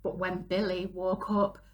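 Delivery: ordinary delivery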